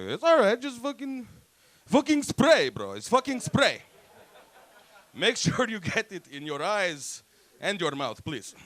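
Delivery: high, loud pushy salesman act; acted insistent urging. Man tells buyer to spray the dusty cologne, insistent.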